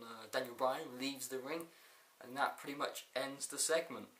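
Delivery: ordinary talk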